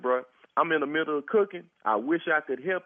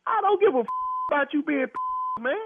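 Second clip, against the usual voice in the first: furious; 10 semitones higher than usual. Real, not acted. Neighbour loses his temper.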